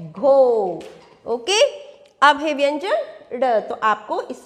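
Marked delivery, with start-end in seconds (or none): 0.1-0.8 घो loud and low, "oh" held; teacher's model घो with a stretched "oh"; long O sound. Shown as a demonstration. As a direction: draw out घो as "ghohh". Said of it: "इसको क्या पढ़ेंगे".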